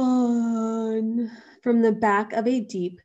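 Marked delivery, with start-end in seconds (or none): 0.0-1.3 big yawn. A narrator's reading. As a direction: let out a big, sighing yawn. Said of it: "let out a big".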